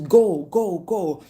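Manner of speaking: repeated loud order, easing off; mock shouted urging; retold shout. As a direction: imitate a child shouting to hurry someone. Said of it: "And he shouts to her".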